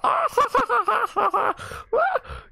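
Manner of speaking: very high creature cackle; long staccato laugh in a tiny squeaky voice; Gizmo. Acted. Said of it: "Like a, a, the Gizmo".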